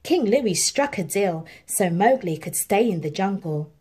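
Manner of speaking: plain reading voice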